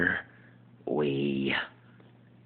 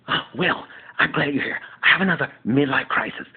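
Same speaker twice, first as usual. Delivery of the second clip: higher, louder flamboyant sitcom voice; Jack impression; 6 semitones higher, 5 dB louder than usual. Jack telling Will about his crisis, high and loud. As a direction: burst in with drama. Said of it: "impersonation of ... Just Jack".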